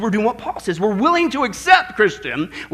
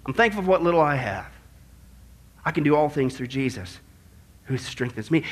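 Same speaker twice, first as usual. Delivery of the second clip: soft, low, humble gratitude; hushed, peaceful confession of faith; thankful acceptance; 8 semitones lower, 6 dB quieter than usual. Retold or imitated. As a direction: drop down low and say it quietly, content. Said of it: "here's Paul's attitude".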